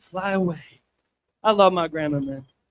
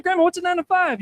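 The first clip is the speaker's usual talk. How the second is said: put-on questioning kid; 8 semitones higher than usual. Child self asks grandma.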